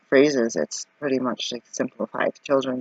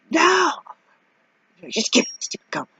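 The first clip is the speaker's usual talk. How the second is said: mock fed-up shout. Fed up with clerk.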